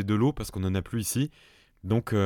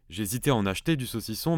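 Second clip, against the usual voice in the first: normal talking voice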